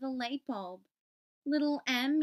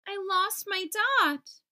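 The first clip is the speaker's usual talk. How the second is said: high, louder, slow upset cry; tearful wail; 7 semitones higher, 5 dB louder, slower than usual. Acted. Little I crying to his father, high and slow. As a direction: wail it like a teary child. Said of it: "sniffed back tears".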